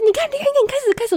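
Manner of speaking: very high mock triumph; gloating mom. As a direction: mom pointing out the coughing fit. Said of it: "她说".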